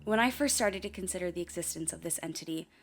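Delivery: ordinary delivery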